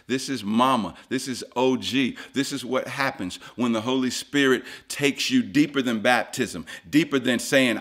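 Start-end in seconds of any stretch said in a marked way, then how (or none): none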